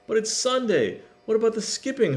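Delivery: put-on high child's plea; high pleading little brother; brother calling. A narrator's reading. Little brother calls after Tilly, high and pleading. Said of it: "her brother called".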